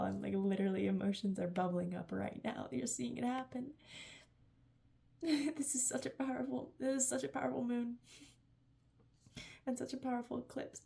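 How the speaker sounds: usual speaking voice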